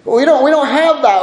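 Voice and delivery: high-pitched, stumbling protest; people's protesting response. Acted. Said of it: "we see the people's response here".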